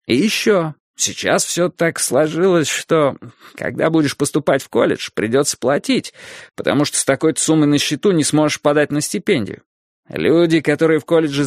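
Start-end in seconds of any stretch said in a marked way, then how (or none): none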